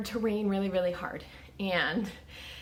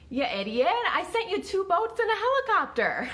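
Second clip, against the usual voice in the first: rising, much higher, incredulous scolding; exasperated God; 9 semitones higher than usual. Acted. God scolding the drowned pastor in heaven, exasperated. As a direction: scold him like a fed-up deity. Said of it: "And God said".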